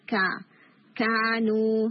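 کا، کانو with a firm stop on the ک; crisp unaspirated ک in کا، کانو; demo of kaaf. Shown as a demonstration. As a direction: clean ک sound in کانو. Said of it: "جب آپ اس کو حرکہ کے ساتھ بولتے ہیں".

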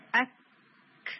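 اک with a quick stop and a brief puff of air; short crisp release on اک; correct sakin kaaf. Shown as a demonstration. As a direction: clean اک. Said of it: "یوں کر کے".

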